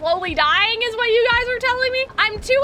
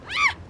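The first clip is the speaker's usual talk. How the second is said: short high startled scream; real jump-scare scream; 12 semitones higher, 4 dB quieter than usual. Real, not acted. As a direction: scream briefly, caught off guard. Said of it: "I didn't mean to scream".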